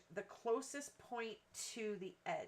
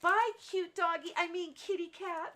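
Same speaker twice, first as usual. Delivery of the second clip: put-on high, loud sing-song child farewell; little girl's goodbye; 9 semitones higher, 9 dB louder than usual. Little girl leaving after trick-or-treat, high, loud goodbye. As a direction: wave goodbye like an excited kid. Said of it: "she goes".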